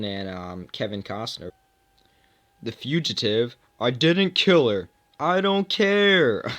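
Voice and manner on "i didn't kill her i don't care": loud, slow, drawn-out protest; acted movie shout. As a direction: shout it out, stretching the last words. Acts out Fugitive confrontation.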